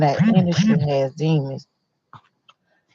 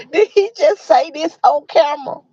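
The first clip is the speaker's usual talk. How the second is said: disbelieving; 8 semitones higher than usual. Real, not acted. Disbelief at interview.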